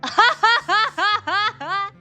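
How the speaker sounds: loud high burst of laughter; big laugh. Real, not acted. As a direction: crack up loudly.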